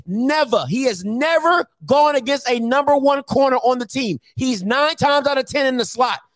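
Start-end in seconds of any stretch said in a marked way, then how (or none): none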